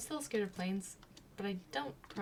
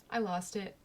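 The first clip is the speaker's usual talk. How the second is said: ordinary talk, nothing marked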